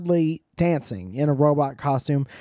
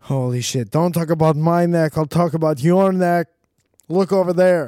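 loud, higher defensive snap; defensive robot; 4 semitones higher, 7 dB louder than usual. Pretend. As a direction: get touchy and deflect loudly. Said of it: "The robot's real defensive".

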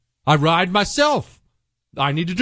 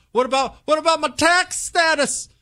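high, whiny mock pastor; mock worried pastor; 13 semitones higher than usual. Host mocking timid pastors in a whiny voice. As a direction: whine it like a timid preacher.

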